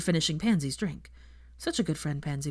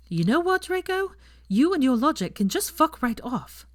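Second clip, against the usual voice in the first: higher, louder, exasperated snap; fed up, tell him off hard; angry outburst; 9 semitones higher, 4 dB louder than usual. Acted. Hermione snaps at Draco.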